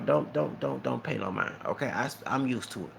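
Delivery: ordinary delivery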